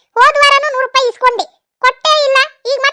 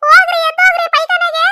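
ಹೋಗ್ರಿ pitched much higher, scolding; shrill shooing; 7 semitones higher than usual. Acted. Wife scolding husband off to the toilet, high-pitched. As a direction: chase him off, voice leaping up on ಹೋಗ್ರಿ.

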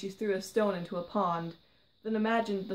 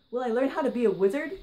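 higher, louder and fast, bursting with eagerness; eagerly; 5 semitones higher, 7 dB louder, faster than usual. A narrator's reading. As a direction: ask it like a thrilled little girl. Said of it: "she asked eagerly".

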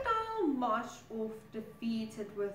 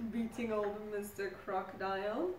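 ordinary delivery

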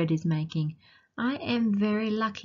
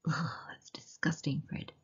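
laughing, whispered ugh; disgusted whisper; 2 semitones lower, 8 dB quieter than usual. Real, not acted. Teacher reading to kids, whispered disgust. Reacting to dog sniffing trees.

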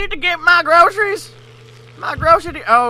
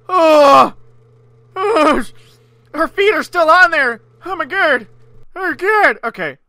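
ordinary delivery

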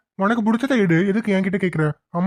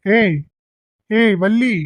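louder call across the shop; raised call to Valli; 3 semitones higher, 3 dB louder, much slower than usual. Acted. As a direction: call her name to get her attention. Calling out to Valli.

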